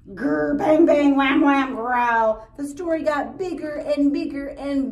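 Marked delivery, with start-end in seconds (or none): 0.1-2.5 loud booming bang and wham; teacher recapping the growing noises; monster noises. Shown as a demonstration. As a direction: punch each noise out big.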